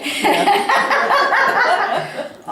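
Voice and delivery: a high burst of laughter. Real, not acted. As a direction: laugh before the punchline. Laughing before her reply.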